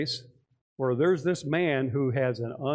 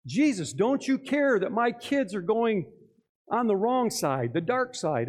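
high, drawn-out acted appeal; pleading cry; 6 semitones higher than usual. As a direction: implore as a desperate parent. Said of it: "They started shouting at Jesus".

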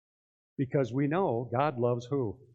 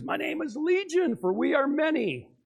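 much higher, proud demon announcing itself; 11 semitones higher than usual. Retold or imitated. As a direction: speak as the many demons answering Jesus. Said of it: "and he says".

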